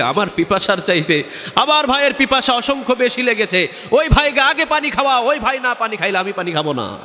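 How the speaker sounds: high, pressing retold plea; imitated urgent insistence. Preacher voices a dying man putting his brother first, high urgent plea. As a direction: insist urgently on the other man's need.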